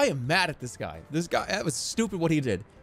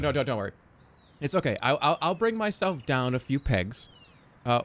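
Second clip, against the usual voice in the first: ordinary delivery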